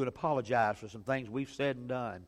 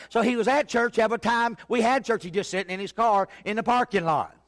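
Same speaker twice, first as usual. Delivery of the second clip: loud, higher preacher's call; preaching at full force; 7 semitones higher, 6 dB louder than usual. Acted. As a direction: build the story up from the pulpit.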